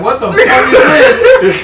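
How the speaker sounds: high, slow mock outrage; imitating a cartoon character. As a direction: squawk it like a cartoon. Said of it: "He's like".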